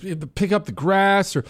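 begging. Retold or imitated. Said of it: "she's begging me".

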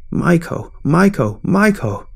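repeated yelling. A narrator's reading. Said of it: "she yelled again".

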